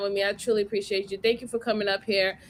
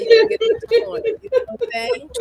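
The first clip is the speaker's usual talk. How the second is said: squeaky high teasing voice; 12 semitones higher, 4 dB louder, faster than usual. Real, not acted. Teasing the donor about the corner, high playful voice. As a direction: tease him in a high playful voice.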